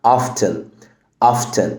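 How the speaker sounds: "often" as "off-ten", T pronounced; sounded T. Shown as a demonstration. Teacher gives American alternative, "often" with audible T. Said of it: "تنطق ال T وتقولها".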